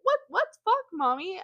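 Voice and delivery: put-on high-pitched little-kid question; mock little-girl voice; child's voice. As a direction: imitate an innocent child, high and loud. Voices child echoing the swear.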